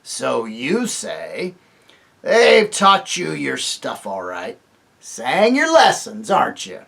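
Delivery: loud, slow mocking dwarf; sneering dwarf. Griffle mocks Jill, slow and sneering. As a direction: sneer at the children.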